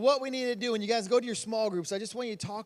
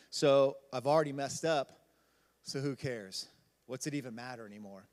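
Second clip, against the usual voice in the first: voice dropping; 7 semitones lower, 4 dB quieter than usual. Retold or imitated. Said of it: "hear the value in her voice drop".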